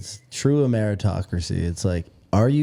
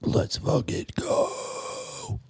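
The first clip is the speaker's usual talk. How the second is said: hushed scream on an unvoiced breath; whisper yelling; 7 dB quieter, much slower than usual. Shown as a demonstration. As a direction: yell it but only in a whisper. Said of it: "I learned about whisper screaming".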